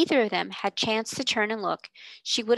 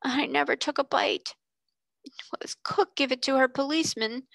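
crying; 5 semitones higher than usual. A narrator's reading. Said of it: "cry into her pillow".

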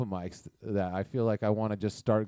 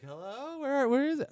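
high, singsong searching call; high-pitched imitation of a searching mom; 14 semitones higher than usual. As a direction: call lightly through the house, high-pitched. Voices mom calling out for daughter.

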